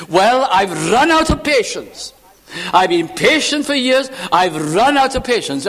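exasperated, high-pitched mock complaint; acted human exasperation; out of patience. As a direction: protest as someone whose patience has run out. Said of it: "Because I talk like this and you talk like this".

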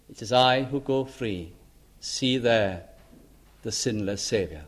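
soft devotional recital. Acted. Recites hymn in prayer.

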